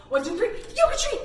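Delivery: fast loud laughing call; game call. Acted. Teacher calls the freeze in the game, loud. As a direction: spin round and shout it out.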